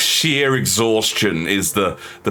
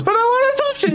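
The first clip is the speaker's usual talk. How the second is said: put-on whiny kid.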